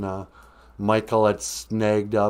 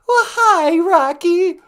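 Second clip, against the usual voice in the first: high squeaky wife greeting; 8 dB louder, slower than usual. Acted. Wife greeting the caller Rocky, high and squeaky.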